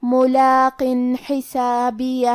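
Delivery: ملاقن حسابیہ very slow, clear نون before the ح with no nasal hum; slow model reading for students; tajweed demo. Shown as a demonstration. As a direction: say ملاقن حسابیہ slowly, نون open and plain. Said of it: "اسے نون ساکن پڑھیں گے اور بغیر غنہ".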